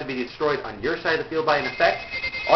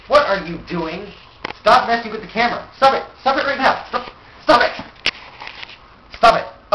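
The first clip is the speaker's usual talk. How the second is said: loud, higher, fast scolding; angry; 6 semitones higher, 5 dB louder than usual. Real, not acted. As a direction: snap at them to stop. Scolding someone at camera.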